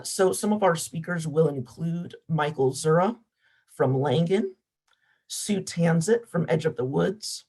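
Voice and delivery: ordinary talk, nothing marked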